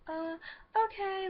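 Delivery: small, high, unsure "uh, okay"; meek high reply. Retold or imitated. Ninth grader answering the girl who cornered her, meek and high. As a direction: squeak it out like a nervous kid.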